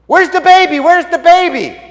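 loud, high, excited yelling; hollering shepherds. Acted. Shepherds bursting into town celebrating, hollering. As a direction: holler it like an overjoyed searcher.

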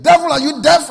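very loud, high acted yell; indignant, high-pitched shouting; exasperated yell. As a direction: yell full force at a pest. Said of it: "And he yelled again".

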